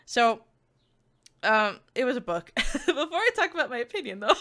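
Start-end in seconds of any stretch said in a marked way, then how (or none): 2.6-4.4 higher, laughing as you speak; amused laughing. Real, not acted. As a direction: let a laugh run through the words. Amused aside while reviewing.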